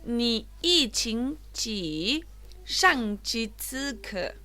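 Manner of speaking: Ni yi qing ji, shang qi zi ke read very slowly, tone by tone; slow Mandarin reading. Shown as a demonstration. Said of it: "la oración uno, que dice". (lectura lenta de la oración en chino)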